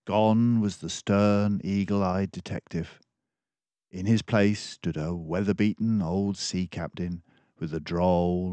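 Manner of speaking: ordinary delivery, nothing marked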